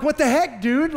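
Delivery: high, loud mock disbelief; incredulous dad. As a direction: blurt it out at your kid, baffled. Dad baffled son goes again.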